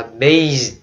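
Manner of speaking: "bass" said clearly as "base"; long A for sound. Shown as a demonstration. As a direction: stretch "bass" into BAYSS. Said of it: "Rất là lạ".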